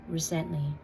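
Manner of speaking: ordinary talk, nothing marked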